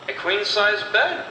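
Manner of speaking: usual speaking voice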